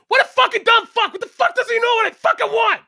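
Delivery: very high, loud screeching tirade; shrieked caricature of an angry sister; mock furious rant. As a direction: explode into hammed-up sibling rage. Sister rants between customers.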